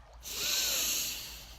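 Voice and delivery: slow, audible meditative exhale; long breath out. Shown as a demonstration. Guide modelling deep breathing beside the water, long exhale. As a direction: let a deep breath go in one long release.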